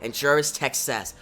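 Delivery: plain reading voice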